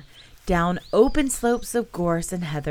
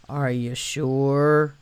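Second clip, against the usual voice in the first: slow, doubtful donkey voice; a mopey donkey unconvinced; gloomy donkey; 3 semitones lower, much slower than usual. Acted. Eeyore doubting.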